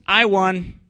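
lightly voiced taunting lady; mock lady voice.

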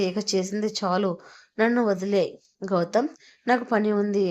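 usual speaking voice

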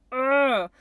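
loud exasperated "ugh"; acted groan of teenage frustration; angry groan. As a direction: groan loudly in annoyance. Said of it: "It's angry".

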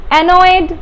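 normal talking voice

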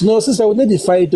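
ordinary talk, nothing marked

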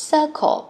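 "Circle" said loud and high, two quick syllables. Shown as a demonstration. Teacher calls the word out to the class. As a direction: punch out "Circle" for the class to hear. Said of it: "Hai âm ha các con".

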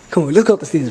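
ordinary delivery

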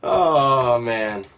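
ordinary delivery

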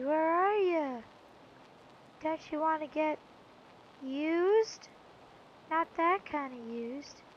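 a creepy stranger coaxing a Pokemon closer, softly and slowly; creepy serial killer. Pretend. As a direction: lure them in like a creepy stranger. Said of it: "sounded way too much like a freaking serial killer".

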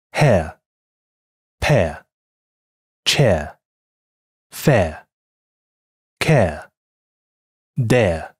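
each word hair, pear, chair drawn out slowly with a clean air glide; slow word drill. Shown as a demonstration. Teacher reads the practice words for students to copy. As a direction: read the practice list slowly, one word at a time. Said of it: "下面我们来练习一下air在几个单词中的发音".

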